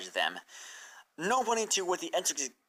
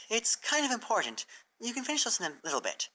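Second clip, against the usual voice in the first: higher, lightly voiced friendly mare; Twilight's voice, a touch higher and kind; 10 semitones higher, faster than usual. A narrator's reading. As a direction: gently nudge a friend, a bit higher.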